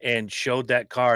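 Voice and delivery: normal talking voice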